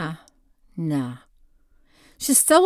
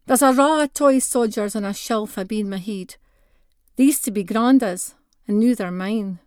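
plain reading voice

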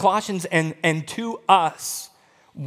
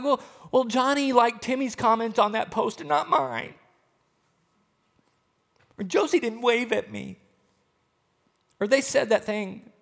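higher-pitched mock complaint; petty whining; 5 semitones higher than usual. Believer grumbling over small slights, petty. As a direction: list slights like a petty whiner. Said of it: "the more silly and petty examples".

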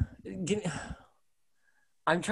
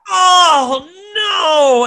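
dismayed cry; 13 semitones higher, 6 dB louder than usual. Real, not acted. Dreaded reading punishment.

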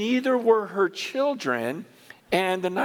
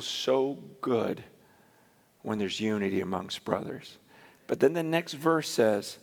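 low, hushed reverent reading; quiet devotional recitation; scripture reading; 11 semitones lower, 4 dB quieter than usual. Acted. Reads scripture verses aloud.

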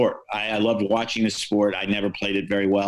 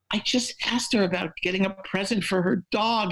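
sheepish reporter; 9 semitones higher than usual. Retold or imitated. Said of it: "I said".

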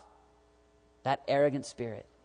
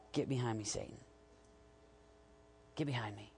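softer, low-voiced get behind me; quiet firm command to Satan; hushed rebuke; 5 semitones lower, 16 dB quieter than usual. Rebuking the devil, dropping away.

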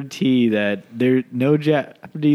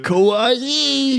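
overacted anime dub; 11 semitones higher than usual. Hammy anime exclamations.

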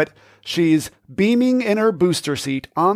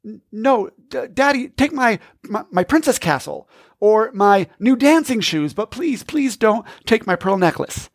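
put-on higher, stammering child's plea; struggling little girl; 6 semitones higher than usual. Daughter begs dad to take her toys instead, in a higher, stammering plea. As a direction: fight tears and bargain like a desperate four-year-old. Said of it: "An internal battle rising inside her".

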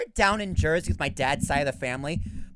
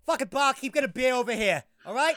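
mock Southie accent; 5 semitones higher, 4 dB louder than usual. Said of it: "a Southie accent where they're saying something unattractive".

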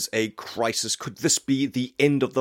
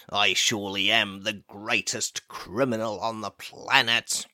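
normal talking voice

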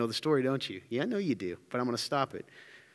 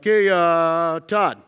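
louder drawn-out call to a player; coach calling out; 7 dB louder, much slower than usual. Acted. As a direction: call Todd up from the bench.

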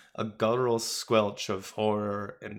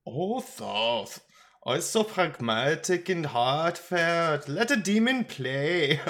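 put-on tipsy, chuckling German accent, higher and drawn out; jovial German drinker; 7 semitones higher, 3 dB louder than usual. A narrator's reading. Old crank teases the feather god, jovial tipsy German accent. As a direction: tease a friend in a boozy German lilt. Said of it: "He chuckled".

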